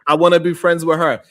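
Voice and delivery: ordinary delivery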